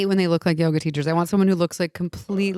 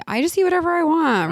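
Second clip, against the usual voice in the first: higher, mock carefree girl; mock skinny-girl quote, pitched up; lightly voiced skinny girl; 10 semitones higher than usual. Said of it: "like, a skinny girl who's like".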